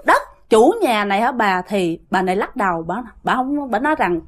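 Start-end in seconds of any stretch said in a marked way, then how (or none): none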